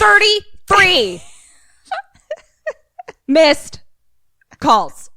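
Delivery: "Thirty-three missed calls" slow, high, loud, word by word; emphatic. Real, not acted. Stressing her thirty-three calls.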